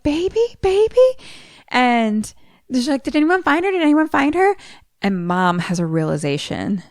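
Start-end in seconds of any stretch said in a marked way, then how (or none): 0.0-1.0 mom calling. Retold or imitated. Mom searching for her daughter. 3.0-4.5 frantic mom. Retold or imitated. Frantic mom asking around.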